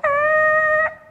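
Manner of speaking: high screeching tyre noise. Shown as a demonstration. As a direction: squeal like tyres on tarmac. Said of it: "Can you do that noise?".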